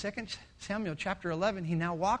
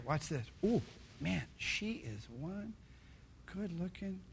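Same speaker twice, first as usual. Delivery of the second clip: lustful murmur; 3 semitones lower, 11 dB quieter than usual. Acted. King ogles Bathsheba.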